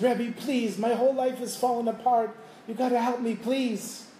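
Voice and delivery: high-pitched mock plea for help; acted-out begging, pitched well up; desperate pleading. Broke man begs the Rebbe for help, desperate pleading.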